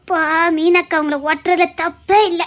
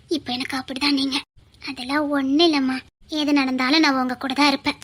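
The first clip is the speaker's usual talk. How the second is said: tender comfort; 5 semitones lower, 6 dB quieter than usual. Acted. Wife comforting husband tenderly.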